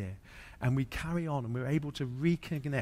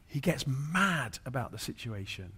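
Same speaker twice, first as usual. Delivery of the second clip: louder, higher rising preacher's fervour; impassioned preaching; 5 semitones higher, 6 dB louder than usual. Acted. Preacher urges the congregation to get indignant, loud and fervent.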